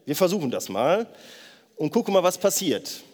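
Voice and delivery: ordinary talk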